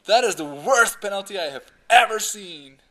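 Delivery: loud outraged commentator call; aggressive commentator. Retold or imitated. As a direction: belt it like a furious pundit. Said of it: "aggressive style".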